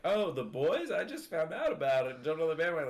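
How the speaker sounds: mock someone pretending it's new to them; faked casual discovery. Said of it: "try to fake that you".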